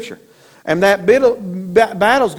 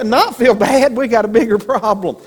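ordinary talk

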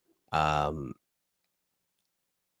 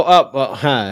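usual speaking voice

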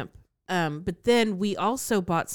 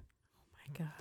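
horrified whisper; 2 semitones lower, 20 dB quieter than usual. Real, not acted. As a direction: breathe it out in disgust. Reacting to swallowed goldfish.

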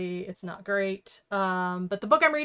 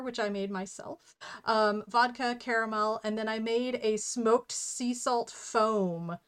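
ordinary delivery

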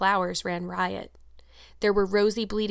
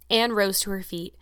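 ordinary delivery

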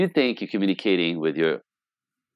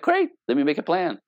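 oblivious husband; 7 semitones higher than usual. Retold or imitated. Oblivious husband replies to wife.

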